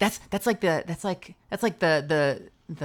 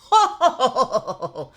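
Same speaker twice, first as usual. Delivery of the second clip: high, loud villain laugh; a hammed-up evil chuckle; Frieza laugh; 9 semitones higher, 7 dB louder than usual. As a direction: cackle like a cool tyrant. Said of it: "a little bit of a Frieza laugh".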